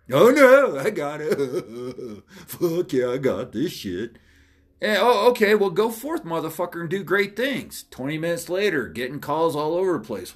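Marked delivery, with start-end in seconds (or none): 0.1-4.2 mock cocky reply with a laugh; overconfident underling. Acts out overconfident person taking orders. 4.8-7.8 higher-pitched mock encouragement; mock send-off. Sends the confident underling off.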